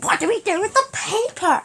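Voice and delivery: high, loud outburst; mock panicked question. Cousin asks about sweet wrappers, mock panic. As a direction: blurt it out in an exaggerated high voice.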